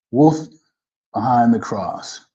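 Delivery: slow and spaced out, word by word; deliberate, with a pause after the first word; slow title reading. Real, not acted. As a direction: read the title really slowly so it lands. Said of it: "I'm gonna read it really slowly so you can hear it".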